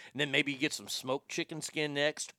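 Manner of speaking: ordinary talk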